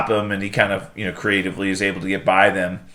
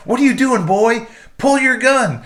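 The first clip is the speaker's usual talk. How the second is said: much higher, hammed-up taunting father; mock father taunt; 13 semitones higher than usual. Ghost father taunts Peacemaker to draw, hammed-up high taunt. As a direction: goad your son in a silly high voice. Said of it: "And he's like".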